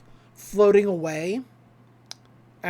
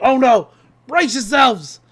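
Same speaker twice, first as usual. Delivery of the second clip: urgent warning; 3 semitones higher, 9 dB louder, slower than usual. Retold or imitated. Stan warning of the anomaly.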